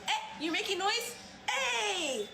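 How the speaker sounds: high, laughing cheerful call; forced cheerful. Shown as a demonstration. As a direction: greet them gleefully. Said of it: "So my happy one is gonna be".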